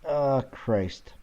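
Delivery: normal talking voice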